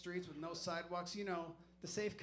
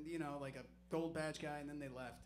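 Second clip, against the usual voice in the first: ordinary talk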